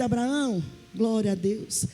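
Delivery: booming sermon delivery; preaching as God. Acted. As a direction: proclaim God's words with force.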